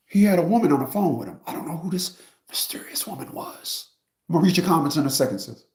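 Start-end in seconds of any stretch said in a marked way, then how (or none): 1.5-3.9 whispered aside. Real, not acted. Telling viewers about the mystery woman, whispered. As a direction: lean in and whisper it.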